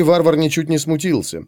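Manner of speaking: usual speaking voice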